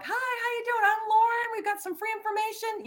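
high, bright call across the lot; friendly outreach call to a stranger; cheery sidewalk greeting. Retold or imitated. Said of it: "So I call out across the parking lot".

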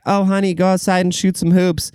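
high, sugary mom voice; sweet mom. Retold or imitated. Mom coaxing her bored kid outside, high and sweet. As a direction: coo it like a doting mother. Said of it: "She'd be like".

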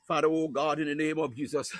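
ordinary talk, nothing marked

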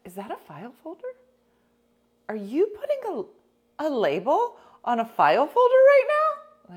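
high, rising, amazed friend's voice; imitating Wendy. Imitating Wendy catching her labelling folders, high and amazed. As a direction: gasp it out like a shocked friend. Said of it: "she goes, she goes".

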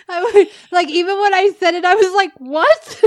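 high and loud through laughter; can't stop laughing mid-sentence; laughing loudly. Real, not acted. Laughing, surprised at herself.

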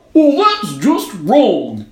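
loud, high, slow snowman outcry; indignant snowman cry. A narrator's reading. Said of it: "it cried".

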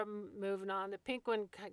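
ordinary delivery

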